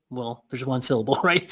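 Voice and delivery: ordinary talk, nothing marked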